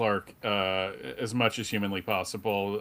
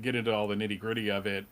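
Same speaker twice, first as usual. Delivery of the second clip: ordinary delivery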